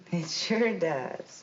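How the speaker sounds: drawn-out, chuckling agreement; amused chuckle. Acted. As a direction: laugh first, then answer slowly. Amused witness recognises the joke again.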